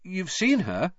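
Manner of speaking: put-on high young boy's voice; earnest boy. A narrator's reading. Harry answering his uncle, earnestly.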